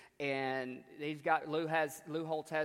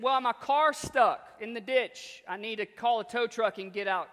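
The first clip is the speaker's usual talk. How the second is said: calling back; 6 semitones higher, 6 dB louder than usual. Retold or imitated. Stuck coach calling back to the farmer, loud. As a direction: call it across the distance.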